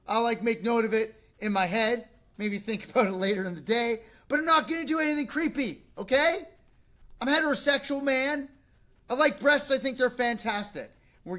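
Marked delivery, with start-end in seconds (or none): 4.3-10.9 high and loud, protesting hard; a loud defensive rant; defensive outburst. Real, not acted. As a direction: insist loudly that you're no creep. Defensive outburst about liking breasts.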